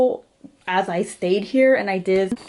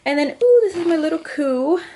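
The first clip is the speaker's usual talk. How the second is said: excited; 8 semitones higher, faster than usual. Real, not acted. Showing off a cute toy.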